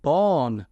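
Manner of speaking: "born" said to rhyme with Sean; rhymed mispronunciation. Shown as a demonstration. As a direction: say "born" as Bean rhymed with Sean, set apart. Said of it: "then Bean should be".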